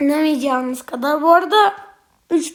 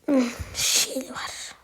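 slow, thinking whisper; slow whispered musing; 4 semitones lower, 10 dB quieter, much slower than usual. Real, not acted. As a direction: murmur it slowly to yourself. Sneaking in game.

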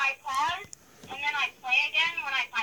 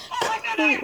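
excited shout; 4 dB louder than usual. Real, not acted. Thrilled at the find.

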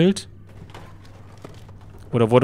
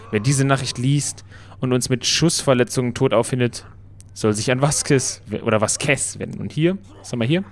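ordinary talk

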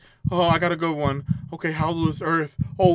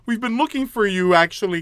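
ordinary delivery

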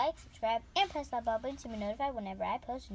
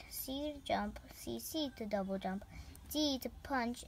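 whispering; 6 dB quieter than usual. Real, not acted. Whispered recap.

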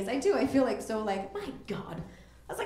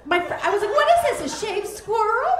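baffled disbelief; 12 semitones higher than usual. Retold or imitated. Woman staring at an unsolicited photo, high-pitched baffled squeal. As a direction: rise up in total confusion.

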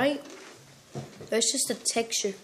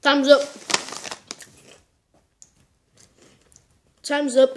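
loud; 3 semitones higher, 6 dB louder than usual. Real, not acted. Challenge timer ends.

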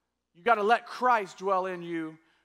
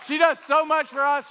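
loud, higher shout of praise; 5 semitones higher, 6 dB louder than usual. Real, not acted. Pastor praising the helper to the church, loud. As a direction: call it out over the applause.

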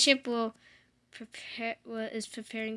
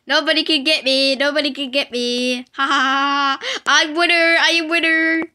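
loud triumphant taunting, laughing; gloating shout; 4 semitones higher, 18 dB louder than usual. Real, not acted. Player shouting at other players that nobody can get him, gloating loudly. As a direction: crow over everyone, full volume. Gloating safe in bean store.

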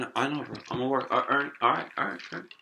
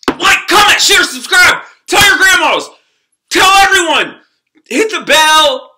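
higher and louder outro call; hype shout; 9 semitones higher, 9 dB louder than usual. Real, not acted. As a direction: belt it like a hype man.

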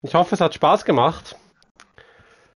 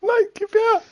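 excited squeal. Real, not acted. Grabbing for items amid laughter.

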